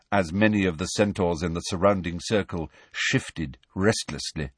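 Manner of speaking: ordinary delivery, nothing marked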